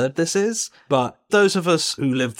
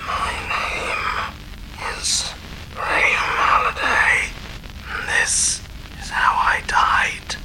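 small, raspy, whispered dead man; raspy ghost whisper; slower than usual. Acted. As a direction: breathe it out like a ghost on tape. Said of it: "small, raspy voice, speaking very low".

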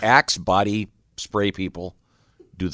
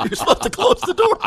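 much higher squeaky joke voice; high falsetto; 5 dB louder than usual. Acted. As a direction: pitch it way up in falsetto. Joking falsetto quip.

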